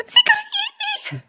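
very high frightened squeak. Acted. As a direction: whimper in terror. Gnome fears being eaten.

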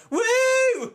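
high, loud mock crowd woo. Mimicking a dumb crowd cheering, high loud woo. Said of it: "the crowd, like, are like".

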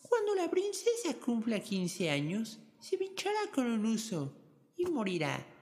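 high, slow, menacing fairy's curse; vengeful witch voice. Acted. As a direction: pronounce the curse slowly like an evil witch. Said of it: "exclamó".

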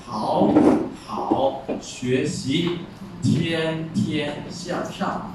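好好学习 drawn out very slowly, each tone in full; slow tone demo. Shown as a demonstration. Teacher slowing down so the third tone is heard. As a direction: stretch 好好学习，天天向上 out syllable by syllable. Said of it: "所以说".

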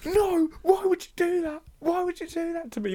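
very high, anguished cry into the air; distraught pleading. Retold or imitated. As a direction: beg the empty taxi rank in despair. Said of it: "speaking out loudly to myself 'cause I'm so mad".

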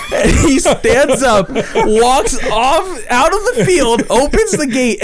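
can't stop laughing as he tells it; laughing while narrating. Real, not acted. Laughing while telling the story.

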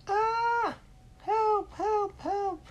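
very high, loud trapped cries; boy in the net squealing for help; cry for help. Acted. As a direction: yelp like a kid dangling in a net.

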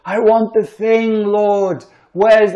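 put-on very high, slow begging baby voice; mock baby pleading to God. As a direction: plead up to God like a helpless infant. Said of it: "to the sad baby, that's God".

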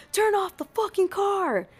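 high-pitched, drawn-out mock yell; slow, high mock command; retold cop yell. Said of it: "they're cussing".

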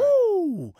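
high, loud woo; wrestler woo. Retold or imitated. Practicing the wrestler woo at home, high and loud. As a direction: howl it like a wrestler. Kicking in the door.

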